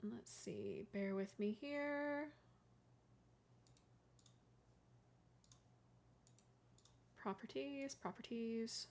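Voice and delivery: half-whispered mutter to herself, slow; muttered under breath. Real, not acted. Streamer muttering to herself while fixing her settings, hushed. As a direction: mumble it while busy clicking.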